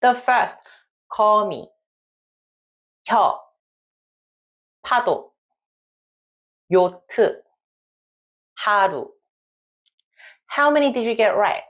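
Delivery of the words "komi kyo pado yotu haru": each word said clearly and alone, with long gaps; careful word-by-word reading; test words read out. Shown as a demonstration. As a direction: model "komi" and "pado" for learners to check. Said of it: "Let's try reading these five words".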